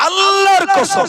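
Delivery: high, loud, slow swearing by God; oath shout. Acted. Preacher vouching to the crowd, high slow loud oath. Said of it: "swearing oath mid story".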